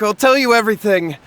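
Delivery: ordinary talk, nothing marked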